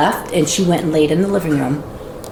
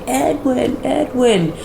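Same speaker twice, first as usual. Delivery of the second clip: calling out weakly; 7 semitones higher, much slower than usual. Retold or imitated. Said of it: "And she's like".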